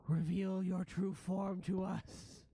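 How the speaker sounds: slow, high, loud creature voice; rat prince. Acted. Rat prince to the ghosts, slow loud creature demand. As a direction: demand it slowly and dramatically. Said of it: "and the rat prince says".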